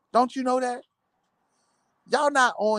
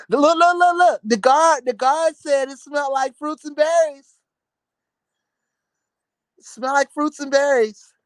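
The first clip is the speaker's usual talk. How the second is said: mock gossip report; 4 semitones higher than usual.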